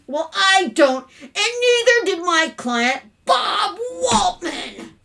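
loud list building to a shout; mounting indignation. Acted. Father throwing his son's antics back at his wife, increasingly furious.

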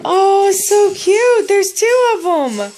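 high, slow delighted squeal over cute frogs; gushing. Real, not acted. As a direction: melt over a tiny gift. Gushing over baby gifts.

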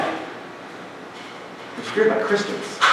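slow, breathy whisper; dramatic whisper. Acted. Preacher hushes to stress how Saul was feared, slow whisper. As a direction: hush it like a dark secret.

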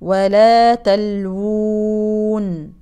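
تلوون drawn long, stopped on a silent nun; madd on pause. Shown as a demonstration. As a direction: stretch تلوون and stop. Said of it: "مد عارض للسكون". (مد عارض للسكون)

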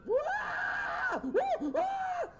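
high, loud mock screams; imitated screaming. Imitating the actress's endless scream, high loud wails. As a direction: wail like a siren of a scream. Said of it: "She's still".

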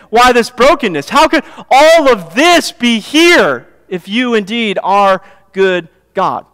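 loud, rising preacher's cry of why; fiery sermon plea, voiced for the doubter; preaching at full force. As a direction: hammer each why at the congregation.

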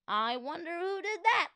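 high, wondering question; puzzled fan. A narrator's reading. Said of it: "asked another fan".

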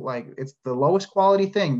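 ordinary delivery

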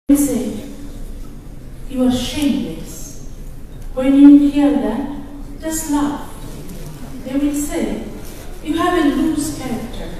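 rising, declaiming poem reading; poem recital. Acted. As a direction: read it like a defiant anthem to an audience. Reads her translated poem aloud.

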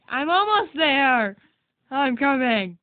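calling out across the map; calling from far away. Real, not acted. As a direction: shout it to someone far off. Calling out while running over.

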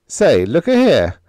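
high, loud, sudden announcement; sudden interjection. Acted. Hank out of a brown study, speaking up suddenly. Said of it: "said suddenly".

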